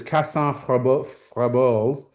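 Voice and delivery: a light French accent on Cassin Frabold. Shown as a demonstration. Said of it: "my attempt at a French accent".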